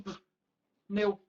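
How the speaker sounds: bare p, long pause, then neu; consonant split off. Shown as a demonstration. Teacher proves that syllable cannot exist, p- apart from neu. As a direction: detach vowelless p- from neu. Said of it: "se eu separo aqui".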